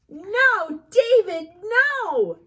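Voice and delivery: high, loud alarmed mother; shrill scolding mom. Acted. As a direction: squeal it at the naughty boy.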